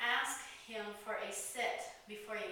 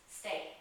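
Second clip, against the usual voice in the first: low, firm command; firm stay. Shown as a demonstration. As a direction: say it with calm authority. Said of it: "in authoritative tone".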